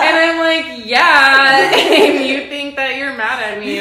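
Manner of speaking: giggling through the words; laughing while talking. Real, not acted.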